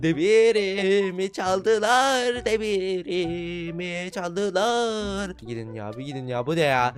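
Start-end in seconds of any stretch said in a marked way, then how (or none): 0.0-2.3 mock lament. Laments stolen iron. 2.4-5.3 mock lament. Laments stolen iron.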